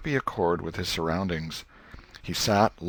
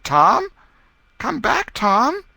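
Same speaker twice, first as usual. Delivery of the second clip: much higher and louder call across the yard; 10 dB louder, slower than usual. A narrator's reading. Becky calling after Tom who ran off, loud call. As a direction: shout for him to come back. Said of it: "Then she called".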